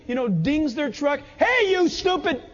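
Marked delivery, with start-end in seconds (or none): 1.4-2.4 high, punchy mock yell; angry outburst. Contractor at whoever dinged his truck, hammed-up angry yell. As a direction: snap it at whoever hit your truck.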